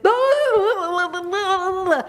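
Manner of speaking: high, loud wordless babbling; imitated garbled babble. Eddie answering about a hamburger, imitated garbled babble. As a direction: holler a string of slurred nonsense sounds. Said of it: "And Eddie said".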